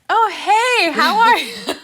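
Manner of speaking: high, loud, over-friendly hello; fake cheery greeting. Caught teen greets family friend, exaggerated fake cheer.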